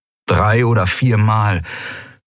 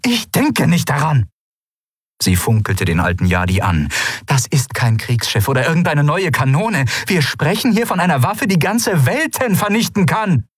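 higher, sharp, indignant refusal; indignant ruler; 7 semitones higher than usual. A narrator's reading. Ruler rebukes the old minister, indignant. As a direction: slap the idea down.

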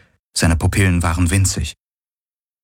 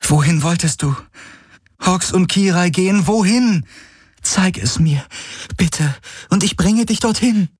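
high, pressing plea; urgent pleading; 11 semitones higher than usual. A narrator's reading. Kirai shows wounded Hawks the map, urgent pleading. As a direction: implore with pitch well up throughout.

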